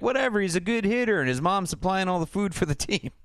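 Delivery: high, breezy dismissive voice; high Steve voice. Retold or imitated. Steve shrugging off the coach's worry, high and airy. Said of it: "Steve is like".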